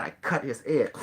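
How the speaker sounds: normal talking voice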